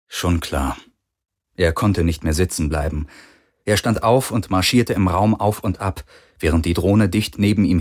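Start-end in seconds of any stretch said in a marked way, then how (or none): none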